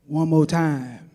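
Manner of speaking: low, slow, heavy crooner voice. Retold or imitated.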